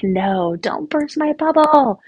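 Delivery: mock a teen pushing mom away; whiny teen. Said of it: "they're like".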